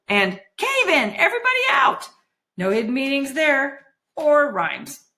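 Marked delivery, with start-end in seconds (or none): 0.6-2.1 high-pitched alarm call; miner's panicky warning; warning cry. A narrator's reading. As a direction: call out an urgent evacuation. Miners' warning cry voiced in narration.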